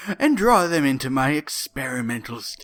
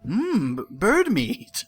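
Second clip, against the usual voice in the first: ordinary talk, nothing marked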